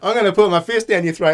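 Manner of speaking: high, loud mock threat; tough American dude. Shopper threatening the agent, hammed-up tough-guy bluster. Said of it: "overweight American dudes that think they're tough".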